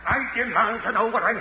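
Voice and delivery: loud, high, indignant demand; shouting demand. Acted. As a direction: shout for the jailer. Prisoner demanding jailer.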